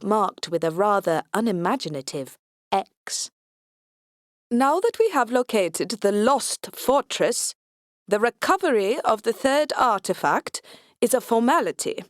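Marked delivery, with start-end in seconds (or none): 4.5-12.0 put-on pompous, Swedish-accented and higher; grand Swedish-accented announcement. A narrator's reading. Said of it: "Every other word he speaks seems to start with a capital letter".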